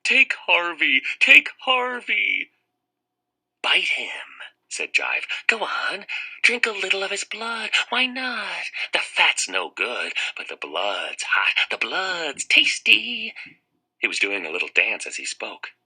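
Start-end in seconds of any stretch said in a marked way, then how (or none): 0.0-2.5 high, slow wailing insistence; sobbing plea. Acted. Sobbing boy offers friend. 3.6-13.4 high, slow coaxing; tempting creature. Acted. Jive tempting Harvey.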